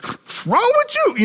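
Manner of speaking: high voiced worried wife; concerned wife. Preacher voicing a wife asking her angry husband, high.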